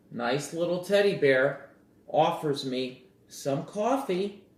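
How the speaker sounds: usual speaking voice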